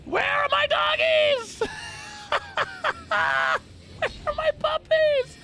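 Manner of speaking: high, slow hammed-up wail; slow high mock lament. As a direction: cry out for the puppies, melodramatic. Mock wailing over lost puppies.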